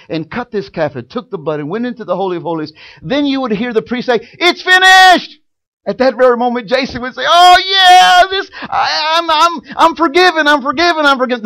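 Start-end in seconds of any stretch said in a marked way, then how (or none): 4.3-5.4 slow, very high and loud proclamation; priest's loud cry. Retold or imitated. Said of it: "they would scream it loud". 7.2-11.4 high, loud, tearful joy; half-sobbing with relief and happiness; overjoyed cry. Retold or imitated. Jason overjoyed at forgiveness.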